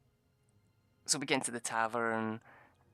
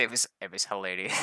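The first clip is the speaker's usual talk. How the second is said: ordinary delivery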